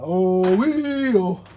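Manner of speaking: mocking a careless digger. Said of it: "They go".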